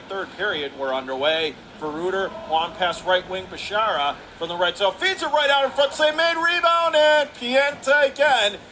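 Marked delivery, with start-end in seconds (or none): 4.9-8.6 excited call. Real, not acted. Live hockey scramble in front.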